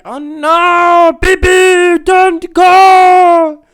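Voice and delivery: loud, high drawn-out mock wail; over-the-top pleading shout; fans' wailing plea. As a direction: cry out like a distraught fan, stretched and high. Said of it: "She'll be like".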